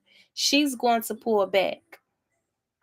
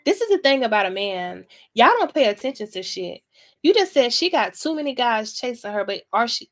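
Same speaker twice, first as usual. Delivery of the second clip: usual speaking voice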